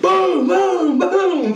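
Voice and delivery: high, laughing boom shots; gun booms. Retold or imitated. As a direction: punch each boom out. Imitating sniper shots.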